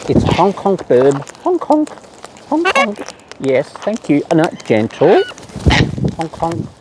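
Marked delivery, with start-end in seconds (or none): 1.4-2.9 high piping Hong Kong; squeaky name. Real, not acted. Naming a bird playfully. 6.2-6.7 Hong Kong said softly, near her usual pitch; soft name. Real, not acted. Naming the bird again softly.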